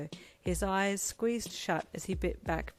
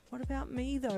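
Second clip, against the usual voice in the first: crying softly; 6 semitones higher than usual. Acted. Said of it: "your mum cried softly".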